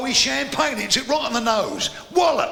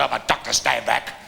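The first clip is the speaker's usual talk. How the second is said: mock doctor; 11 semitones lower than usual. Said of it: "He said".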